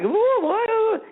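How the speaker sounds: high-pitched mock-startled yelp. Driver reacting to motorcycle cop pulling alongside, startled. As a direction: act out being caught off guard, pitch leaping up.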